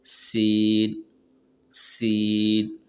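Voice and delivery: سین with a long, drawn-out hissing س; a slow, sustained light s in سین; drawn-out s sound. Shown as a demonstration. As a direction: stretch سین slowly with light air. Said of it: "ہلکی ہوا سے سین ادا ہوتے ہیں".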